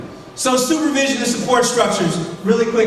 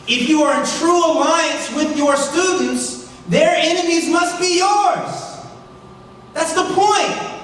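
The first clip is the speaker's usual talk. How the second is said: raised, high, insistent voice; impassioned yelling; 4 semitones higher than usual. Real, not acted. Speaker urging educators to stand with their students, impassioned yelling. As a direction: drive the point home, high and drawn out at the ends. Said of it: "I'm sorry, I'm yelling".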